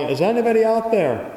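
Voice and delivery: high, loud cry for help from a cliff; desperate yell. Acted. Falling man yells for help from the tree, high and loud. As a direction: shout it out high, like a man dangling from a branch. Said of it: "he starts yelling".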